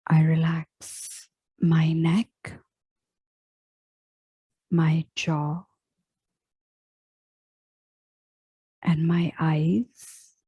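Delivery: very slow meditation voice with long pauses; unhurried body scan, each phrase left to settle; guided relaxation. Real, not acted. Yoga teacher guiding listeners into final relaxation, slow meditative delivery.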